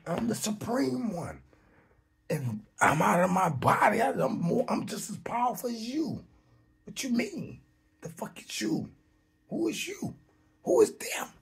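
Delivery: high-pitched, incredulous challenge; defiant boast. Acted. Spirit out of the body challenging the shadows, defiant.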